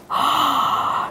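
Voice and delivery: sudden high breathy gasp of fright; a startled gasp mid-story; mock scared gasp. Shown as a demonstration.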